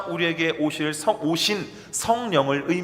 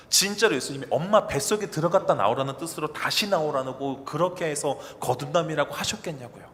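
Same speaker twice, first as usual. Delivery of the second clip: ordinary delivery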